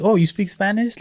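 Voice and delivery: high, delighted "oh, you speak Spanish?"; mock them switching to sweet and surprised; sudden friendly surprise. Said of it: "they immediately changed their tone".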